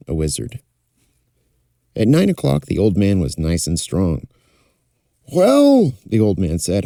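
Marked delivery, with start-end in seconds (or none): 5.3-5.9 put-on higher, loud hearty old man; a cheerful old king, pitched up. Old king recovered speaks.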